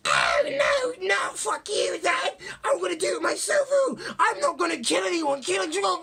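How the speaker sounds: very high, loud shrieking mock tantrum; mock bratty Edward. Edward refusing his father's sacrifice, very high mock shriek. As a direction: screech it like a spoilt teen hero. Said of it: "Edward goes".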